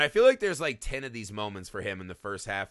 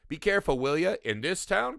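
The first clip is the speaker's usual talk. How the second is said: raised pitch with a questioning lift; 6 semitones higher than usual. Acted. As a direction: pose it as a high, incredulous question. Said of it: "Question mark".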